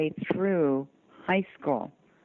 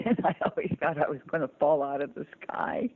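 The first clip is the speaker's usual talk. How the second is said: talking through a laugh; faster than usual. Real, not acted. Guest admitting her fear of falling out of the sky, laughing. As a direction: laugh while saying it.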